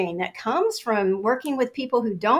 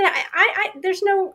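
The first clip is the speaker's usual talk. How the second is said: high, stammering protest; high flustered stammer; flustered sputter; 10 semitones higher than usual. Retold or imitated. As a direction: trip over the words. Sputtering defense to boss.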